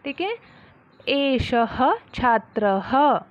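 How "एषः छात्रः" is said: slow model line for pupils to repeat; slow recitation to repeat. Shown as a demonstration. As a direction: say it slowly for the class to copy. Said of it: "जैसे बोलती हूँ वैसे आपने भी बोलना है".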